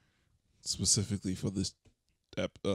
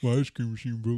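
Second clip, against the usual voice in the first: fast food worker impression. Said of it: "do an impression of somebody working at a, a fast food restaurant".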